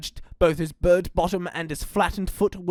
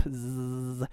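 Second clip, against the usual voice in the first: plain reading voice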